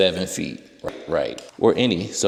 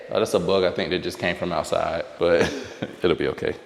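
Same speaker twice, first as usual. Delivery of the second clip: ordinary delivery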